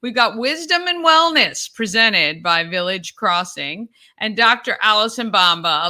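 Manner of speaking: rally the viewers like a show promo; upbeat promo read. Acted. Host pushing viewers to subscribe, loud upbeat promo.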